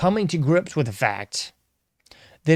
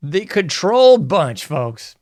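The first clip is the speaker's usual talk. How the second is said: louder, higher, slow announcement; showman announcement; 4 semitones higher, 5 dB louder, slower than usual. Acted. Host introducing his favorite segment to viewers, slow, loud showman announcement. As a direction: announce it like a show title.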